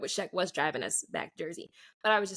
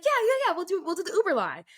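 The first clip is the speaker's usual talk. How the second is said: high, louder rushed yeah-yeah-yeah; eager agreement; 8 semitones higher, 4 dB louder, faster than usual. Retold or imitated. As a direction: gush your yes to the plan. Friend eagerly agreeing to lie.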